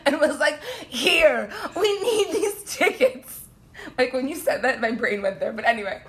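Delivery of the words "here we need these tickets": high, slow, laughing grand-lady voice; mock Nana. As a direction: hand over the gold like a grand old lady. Nana handing over bags of gold.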